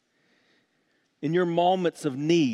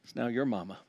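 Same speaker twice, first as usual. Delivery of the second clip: softer, lower, fond voice; tender quote; 4 semitones lower, 5 dB quieter, faster than usual. Retold or imitated. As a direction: speak to a friend and a mother gently. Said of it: "he says".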